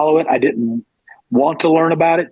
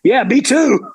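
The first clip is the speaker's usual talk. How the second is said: eager agreement; 10 semitones higher, slower than usual. Retold or imitated. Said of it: "I'm like".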